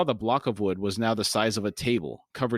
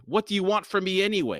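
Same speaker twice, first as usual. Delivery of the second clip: higher-pitched demanding question; 10 semitones higher, faster than usual. A narrator's reading. Chalice confronting Crocus about his motives, demanding. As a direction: demand an answer, voice lifted. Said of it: "she demanded".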